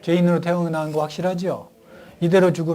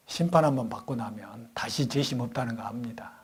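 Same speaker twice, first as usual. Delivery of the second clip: whispered point; 4 semitones lower, 6 dB quieter than usual. Acted.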